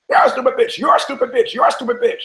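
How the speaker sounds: very high parrot squawk; high-pitched parrot voice. Acted. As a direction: screech it out like a talking parrot. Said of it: "the parrot's looking and goes".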